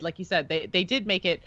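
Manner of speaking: ordinary talk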